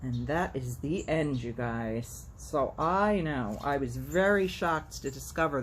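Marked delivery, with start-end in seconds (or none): none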